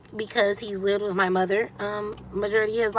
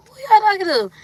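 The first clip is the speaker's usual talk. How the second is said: much higher, louder stern challenge; scolding mom; 11 semitones higher, 6 dB louder than usual. Retold or imitated. Mom confronting sons who talk back, stern and loud. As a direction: call them out like an angry mom.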